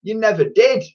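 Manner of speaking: quick high answer back; cheeky retort. A narrator's reading. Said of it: "retorted the boy".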